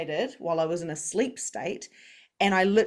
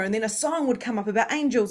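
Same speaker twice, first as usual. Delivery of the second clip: normal talking voice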